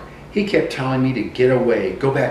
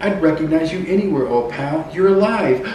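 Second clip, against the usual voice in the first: put-on higher, brighter cry of relief at finding an old friend alive; overjoyed reunion; 7 semitones higher than usual. Pinocchio joyfully greeting his lost friend, excited and higher. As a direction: call out gladly, voice lifting with relief.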